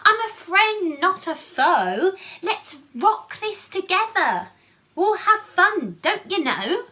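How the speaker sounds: high, loud cheerful mouse. Acted. Mouse reassuring the frightened lion, high and loud. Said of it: "Mouse peeped".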